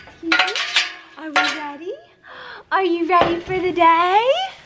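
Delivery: high cooing voice for the animals. Real, not acted. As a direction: coo at the goats like babies. Cooing to her goats.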